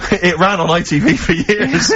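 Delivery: high, slowed by laughter; cracking up mid-sentence; laughing while talking. Real, not acted. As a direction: push the words out through laughter. Cracking up at his own joke.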